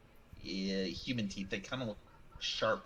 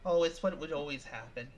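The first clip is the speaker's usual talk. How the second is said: normal talking voice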